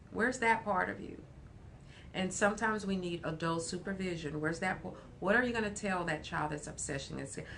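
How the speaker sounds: ordinary delivery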